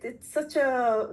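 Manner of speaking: usual speaking voice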